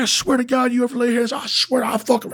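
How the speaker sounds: high mock girl's voice, furious; hammed-up angry girlfriend; girlfriend yelling. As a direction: imitate her screaming threats.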